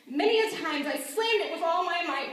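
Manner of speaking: loud, raging outburst; angry. Acted. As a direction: let the fury burst out. Slamming the door in wrath.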